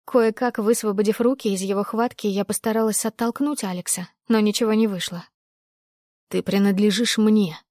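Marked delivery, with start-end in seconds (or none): none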